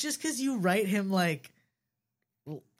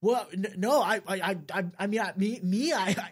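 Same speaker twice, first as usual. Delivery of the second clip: flustered stutter; 2 semitones higher, 4 dB louder than usual. Acted. Caught off guard by her flirting, stuttering. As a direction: stammer in embarrassed surprise. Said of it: "I stuttered out".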